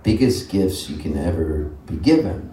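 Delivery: ordinary talk, nothing marked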